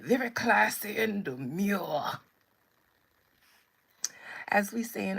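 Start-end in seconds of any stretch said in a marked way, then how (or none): 0.0-2.2 loud, theatrical British catchphrase; playful put-on British accent; mock British accent. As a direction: declare it like a caricature Brit. Repeats mock-British catchphrase after laughing.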